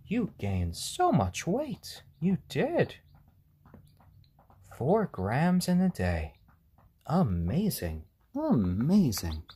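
high, slow praising coo. Real, not acted. Praising Stormy's weight gain, high slow gush. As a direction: gush over the chick's progress.

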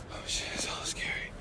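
whispered, a bit spooked; scared whisper. Real, not acted. Exploring a dark ancient site, a scared whisper. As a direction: whisper it so nothing hears you.

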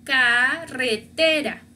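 carretera slow, syllable by syllable, trilled rr; rolled double R. Shown as a demonstration. Teacher models the last example, slow trilled carretera. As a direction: draw out carretera and roll the rr. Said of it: "tenemos el último ejemplo".

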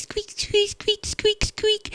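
very high squeaky mouse noises; mouse squeaks. Acted. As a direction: squeak like a tiny field mouse. Field mice running out.

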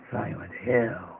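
slow, drawn-out, low spooky title. Acted. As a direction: stretch it out slowly and eerily. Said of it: "Or like this".

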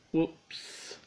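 high breathy little whoops; a sheepish high whoops; Mister Clumsy voice. Acted. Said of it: "said Mister Clumsy".

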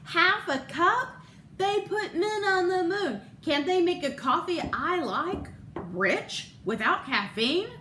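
upset husband crying out about coffee; cries out. Retold or imitated. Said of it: "he cries out".

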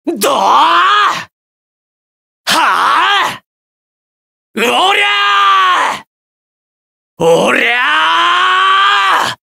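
very high, long, slow power yell; long roaring kiai on a finishing blow; drawn-out battle cry. Acted. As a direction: put your whole body into the strike. Heavy attack cries in combat.

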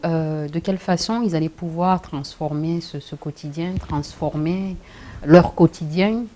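ordinary talk

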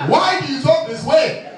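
put-on high, slow, challenging African-accented question; hammed-up suspicious African man, pitched high. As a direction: demand an answer in a mock African accent. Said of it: "my brothers from Africa".

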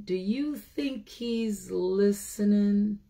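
ordinary delivery, nothing marked

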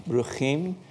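Bruchim with a throaty, rasped ch as in loch; original pronunciation. Shown as a demonstration. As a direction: say Bruchim with a back-of-the-throat fricative ch, not a k. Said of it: "it's supposed to be pronounced".

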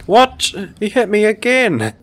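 high, loud, disbelieving protest; indignant outburst. Real, not acted. Player hit again by the forklift, indignant outburst. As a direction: cry out in outraged disbelief.

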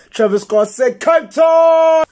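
high, loud, slow stretched yell; shouting the punchline to everyone; triumphant shout. Acted. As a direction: celebrate it at the top of your lungs.

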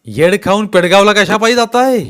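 loud, high scornful retort; rather loudly. Acted. Patil mocking Bhagwanrao's denial, loud sneer. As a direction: snap the jibe back. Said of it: "जरा जोरातच म्हणाले".

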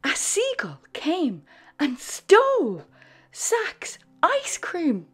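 ordinary delivery

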